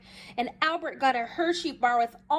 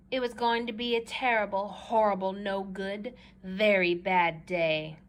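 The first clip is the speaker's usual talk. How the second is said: glum refrain; 6 semitones lower than usual. A narrator's reading. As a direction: drag out very bad day like a sulk. Recurring gloomy refrain.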